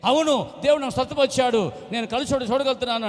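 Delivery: high, loud joyful insisting; insistent shout. Retold or imitated. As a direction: shout it so everyone hears. Answering the barber. (కళ్ళు వచ్చాయని గట్టిగా అరుపు)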